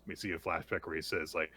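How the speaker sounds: ordinary talk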